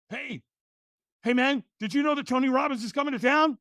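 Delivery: high, eager phone greeting; bursting to share big news; a buddy's high excited call; excited friend. Retold or imitated. Friend excitedly calls about Robbins.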